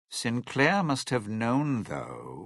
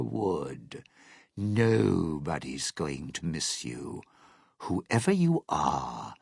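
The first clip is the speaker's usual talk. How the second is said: menacing contempt; 6 semitones lower than usual. Acted. Old resident to a trespassing tourist, coldly contemptuous. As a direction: take your time looking down on them. Acted game villain sneers at intruder.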